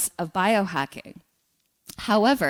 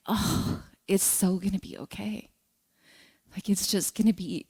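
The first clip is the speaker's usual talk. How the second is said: soft, hushed self-comfort; softly, as if soothing herself; tender reassurance; 6 dB quieter than usual. Retold or imitated. Tender vision of her newborn son.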